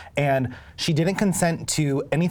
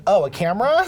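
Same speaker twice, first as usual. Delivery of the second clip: higher, louder mock surprise; delighted surprise; 5 semitones higher, 5 dB louder than usual. Concertgoer noticing the DJ filming him, delighted surprise. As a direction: perk up as if just spotting the lens.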